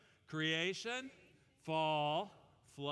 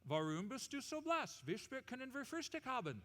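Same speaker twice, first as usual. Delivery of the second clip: German; 7 semitones higher than usual. Acted. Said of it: "God confused the languages".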